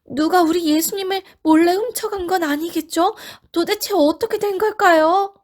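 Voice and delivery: high, anxious voice; worried. Acted. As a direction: fret about the missing body. Women worried the body was stolen.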